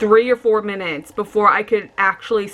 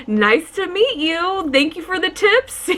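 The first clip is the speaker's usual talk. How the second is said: mock polite; 8 semitones higher, slower than usual.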